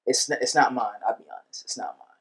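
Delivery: ordinary talk, nothing marked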